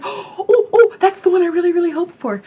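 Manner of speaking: high, breathless eager hope; excited hoping. Retold or imitated. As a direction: squeal with hope for the prize.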